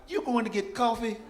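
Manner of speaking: can't believe she's walking off now; incredulous. Retold or imitated. Panicked son asking the tax lady about coffee, incredulous.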